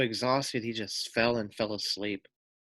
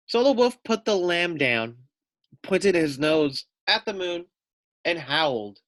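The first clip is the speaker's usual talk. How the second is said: put-on lively, louder read-aloud voice for kids; storybook narration; 8 semitones higher, 6 dB louder than usual. A narrator's reading. Teacher reading a picture book to children, animated narration.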